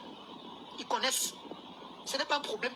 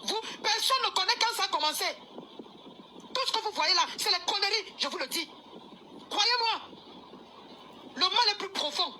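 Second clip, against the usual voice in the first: raised voice; 6 semitones higher than usual. Real, not acted. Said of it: "C'est la dernière fois que je crie".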